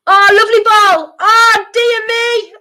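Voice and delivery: loud, high, delighted shout at the ball; excited shout. Real, not acted. Fan watching the match shouts at a lovely ball, excited. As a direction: cheer out, dragging the last oh.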